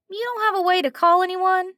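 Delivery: a concerned waitress caught off guard, with a slight twang; slight twang, startled; twang, surprised. Acted. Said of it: "slight twang to it".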